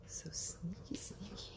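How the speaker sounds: soft breathy whisper, rising; sneaky whisper. Real, not acted. Sneaking out to a dark driveway, whispering. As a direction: tiptoe the words out.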